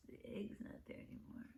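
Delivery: quick hushed whisper. Real, not acted. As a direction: lean in and whisper it. Aside to listening children.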